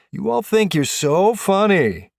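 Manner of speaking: high, loud, drawn-out complaint; indignant sarcasm. A narrator's reading. Prank victim to laughing teammates, indignant sarcasm. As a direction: stretch it out loud at the hecklers.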